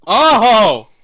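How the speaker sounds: loud, eager cry; excited shout. Real, not acted. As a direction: cheer out loud. Player cheers at a rule.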